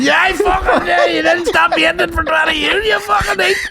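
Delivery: high, loud hammed-up rant; mock furious shout. Son screaming at dad about the stew, hammed-up fury. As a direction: scream back in full Italian fury. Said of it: "lose their mind at everything".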